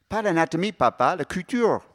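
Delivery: plus haut et plus fort, voix de jeune femme agacée; fille qui corrige. Acted. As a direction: reprends ton père, piquée. Marie corrige son père. (la fille corrige son père, voix aiguë et agacée)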